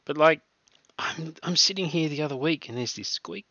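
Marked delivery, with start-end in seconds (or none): none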